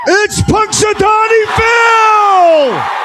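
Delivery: loud, slow, high announcement; announcer shout. Real, not acted. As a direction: proclaim it slowly to the crowd. Announcing the groundhog to crowd.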